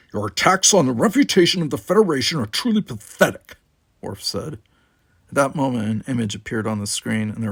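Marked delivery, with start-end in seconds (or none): 0.1-3.6 rebuking. Acted. Worf rebukes Gower.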